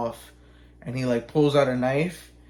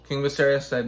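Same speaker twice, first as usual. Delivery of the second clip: ordinary talk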